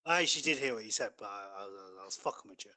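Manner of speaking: ordinary talk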